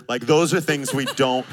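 normal talking voice

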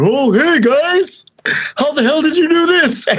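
imitating Bobby. Bobby waddles over amazed, high hammed-up voice. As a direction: greet them like a baffled buddy. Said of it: "here comes Bobby waddling over".

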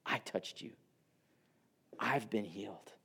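soft, near-whispered admission; quiet confession. Retold or imitated. Healed woman admitting to Jesus she touched him, whispered. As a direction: confess it barely above a breath. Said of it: "She confesses".

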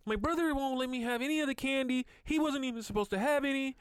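put-on high, complaining kid voice; tattling child. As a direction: imitate a kid telling on a brother. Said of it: "Then they come in the room like".

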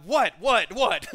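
mock annoyed bark; irritated snap. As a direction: snap at the interrupting servant. Naaman snapping, louder.

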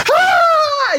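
very high, loud scream of joy; a sudden shriek of victory; joyful scream. Real, not acted. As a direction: shriek like you just won. Face changes on winning.

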